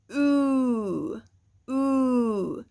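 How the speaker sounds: "oo" from the back of the throat, mouth rounded; back-throat vowel. Shown as a demonstration. Teacher modelling first vowel, held. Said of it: "sound comes from the back of your throat".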